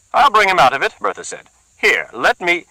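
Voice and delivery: high, loud accusing woman; suspicious woman. Acted. Bertha accuses the muttering man, high and loud. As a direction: bristle with suspicion.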